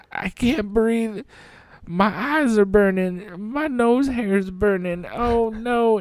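high, strained, gasping complaint; distressed pleading. Retold or imitated. Retold choking on the stench.

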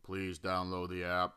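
lightly voiced bored sponsor read; mock ad read. Mocking the boring sponsorship read for his podcast, lightly voiced. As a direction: rattle it off like a dull sponsor script. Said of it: "I won't read it in the".

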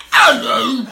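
high-pitched silly British greeting; mock British hello. Shown as a demonstration. Showing a friend how to embarrass himself, mock British accent. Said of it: "just make an ass of yourself".

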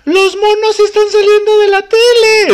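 very high, slow quavering granny voice; stoned old lady. Retold or imitated. High granny panic about cartoons. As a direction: wail it like a spooked old woman.

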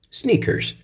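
Sneakers, high with the r, snikeɹs; American sneakers. Shown as a demonstration. Teacher models the American word. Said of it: "in American English".

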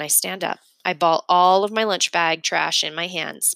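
ordinary delivery

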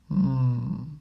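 pondering hum in a small voice; musing little voice. A narrator's reading. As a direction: hum as if weighing it up. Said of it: "said a small voice".

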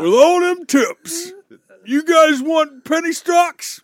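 cheesy stock-tip pitchman shouting his offer; hammed-up pitchman. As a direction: hawk penny stocks to the crowd. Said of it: "I'm Penny Stock Pete".

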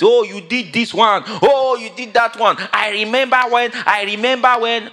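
a hint of a grumbling wife; mock bickering spouse. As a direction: nag like a spouse listing old sins. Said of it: "bickering and grumbling".

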